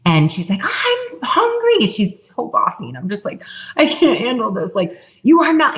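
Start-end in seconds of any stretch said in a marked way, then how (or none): 0.6-1.8 put-on high, drawn-out child's demand; bossy little daughter. 3.8-5.8 higher-pitched exasperated parent, acted out; retold raised voice. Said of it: "like, raising my voice".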